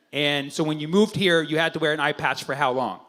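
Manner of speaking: ordinary talk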